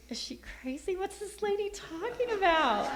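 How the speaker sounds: high, climbing comic disbelief; amused mock bewilderment; incredulous. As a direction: wonder aloud, half laughing, pitch soaring. Acting her private disbelief.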